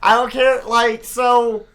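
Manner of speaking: real worked-up rant; triggered outburst. Real, not acted. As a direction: throw it out in exasperation. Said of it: "I'm triggered right now too".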